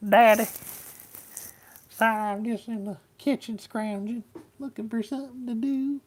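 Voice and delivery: high-pitched Southern talking-dog voice; squeaky, high Southern doggy voice; Dusty dog voice. Pretend. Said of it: "I give him a Southern voice".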